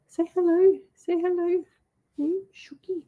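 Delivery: high-pitched pet talk; cooing to a pet. Real, not acted. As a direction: coo to the cat, high and coaxing. Coaxing her pet cat.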